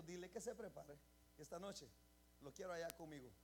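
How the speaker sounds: God quietly telling the prophet; soft divine voice. Retold or imitated. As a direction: say it gently, almost hushed. God's words, voiced very soft.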